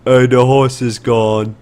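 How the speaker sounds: slow, loud drawn-out horse voice; slow talking horse. Shown as a demonstration. GM voicing the horse to the player, slow and loud. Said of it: "you hear the horse go".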